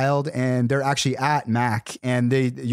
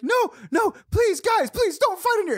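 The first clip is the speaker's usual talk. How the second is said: much higher, panicky pleading; pleading manager. Retold or imitated. Frazzled night manager begs drunk guys, high pleading. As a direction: beg them to stop in a squeaky voice.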